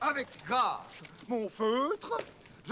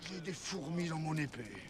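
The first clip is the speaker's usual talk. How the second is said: soft, low teasing aside; quiet mocking; 6 semitones lower, 10 dB quieter than usual. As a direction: drop the voice to mock him. Mocking aside before the duel.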